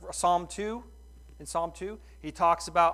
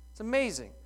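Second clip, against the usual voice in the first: "amazing" higher and stronger; emphatic; 5 semitones higher, faster than usual. Real, not acted. Preacher declares it to the congregation, emphatic. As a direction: proclaim "amazing".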